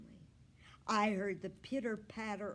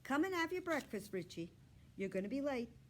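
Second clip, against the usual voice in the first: higher calling mother's voice; mom calling; 6 semitones higher, faster than usual. A narrator's reading. Mom calling son to breakfast from hall. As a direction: call from down the hall. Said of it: "His mom called from the hall".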